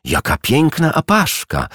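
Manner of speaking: high, delighted exclamation; enchanted, voice lifting high; delighted admiration. A narrator's reading. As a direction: gush with delight, pitched well up. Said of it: "oniemiała z zachwytu".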